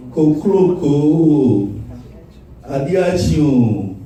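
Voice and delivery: slow naming. Shown as a demonstration. Listing names slowly.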